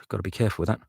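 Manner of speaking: usual speaking voice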